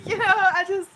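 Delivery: laughing through the words; helpless rueful laugh; real laughing. Real, not acted. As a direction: laugh it out in a high voice. Laughing at her own stuck life.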